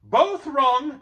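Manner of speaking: loud relieved hobbit cry; cried out. A narrator's reading. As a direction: call out in relief. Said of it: "cried Bilbo".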